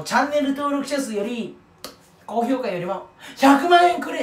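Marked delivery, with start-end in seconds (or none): 3.4-4.2 mock demand. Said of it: "って言って".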